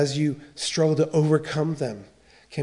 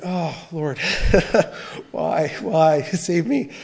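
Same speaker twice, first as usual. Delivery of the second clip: laughing plea; 4 dB louder, slower than usual. Retold or imitated. Pastor retelling his plea to God, laughing despair. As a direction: mock-desperate prayer through laughter.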